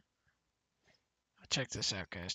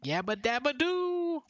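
slow, much higher cartoon voice; cartoon catchphrase voice; 11 semitones higher, 5 dB louder than usual. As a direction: belt it like a cartoon caveman. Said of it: "he goes".

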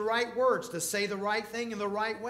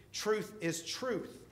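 slow, deliberate, each word weighed; slow emphatic verdict; 3 semitones higher, much slower than usual. Acted. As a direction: lay it down slowly. Flat emphatic assertion.

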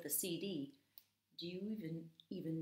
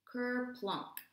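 usual speaking voice

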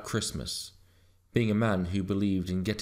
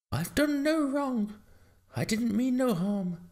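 pleading dog. A narrator's reading. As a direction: plead innocence in a high dog voice. Dog pleads innocence to farmer.

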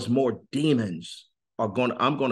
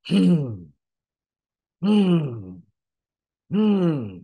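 imitated demon breathing; 6 semitones higher, 4 dB louder, much slower than usual. Guest imitating the demon's breathing for the host. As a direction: hum out the creature's heavy breathing. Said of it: "sound as if it was breathing".

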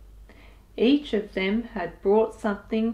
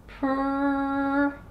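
purr. Acted. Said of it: "said Mog".

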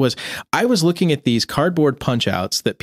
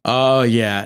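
usual speaking voice

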